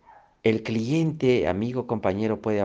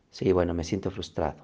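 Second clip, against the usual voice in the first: más grave y más suave, resignado; "me siento frustrado" dicho bajo y suave, aceptándolo; aceptación tranquila; 4 semitones lower, 6 dB quieter than usual. Shown as a demonstration. Ejemplo de identificar la emoción. (reconociendo la emoción con calma)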